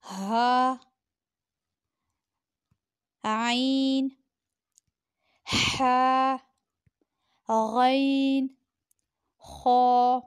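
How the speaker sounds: हा आइन हा गैन खा as a careful throat-letter demo. Shown as a demonstration. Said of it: "हलक से अदा हुआ".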